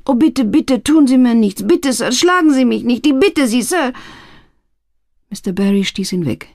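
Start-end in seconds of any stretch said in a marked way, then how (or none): 0.1-3.9 put-on high, pleading old man begging for mercy; frightened pleading. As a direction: beg him not to strike you. Old pawnbroker begs not be beaten. (hoch und flehend, ein verängstigter alter Mann)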